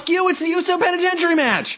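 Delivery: high, fast burst of excitement; excited shout. Real, not acted. Co-host cutting in to call his match prediction, high excited yell. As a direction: blurt the guess out fast and high.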